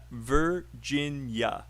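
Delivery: Virginia drawn out slowly, syllable by syllable; broken into syllables. Shown as a demonstration. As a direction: break Virginia into three slow, separate syllables. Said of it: "Break it out and then say three".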